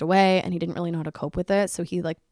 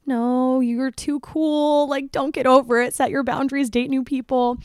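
put-on higher, louder hype-girl push; eager cheering; 6 semitones higher, 3 dB louder, slower than usual. Inwardly cheering on the stranger at the salon, higher eager hype. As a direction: urge her on like a best friend.

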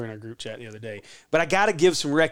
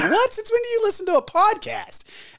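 very high, squeaky incredulous voice; hammed-up shocked husband; shocked disbelief. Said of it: "And he's like".

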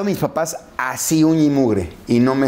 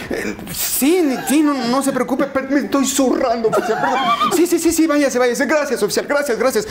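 voz muy aguda, atropellada y nerviosa; actúa la prisa por irse; súplica exagerada; 12 semitones higher than usual. Retold or imitated. Diálogo actuado papá y policía. (papá se despide del policía con voz aguda y nerviosa)

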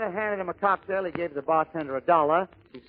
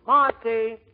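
raised, louder call of a stop; conductor's holler; 4 semitones higher, 5 dB louder than usual. Acted. Streetcar conductor announcing the next stop, hollering. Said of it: "The conductor hollers out".

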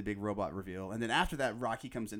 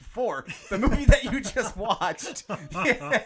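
laughing, higher and louder; 12 semitones higher, 13 dB louder, slower than usual. Real, not acted. Fan laughing at the movie's recap, laughing. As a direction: crack up mid-sentence.

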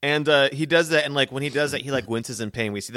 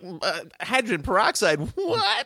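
confused; 9 semitones higher, slower than usual. Pretend. Kid confused by meds.

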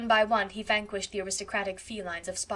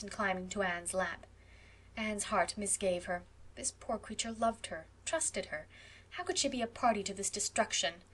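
usual speaking voice

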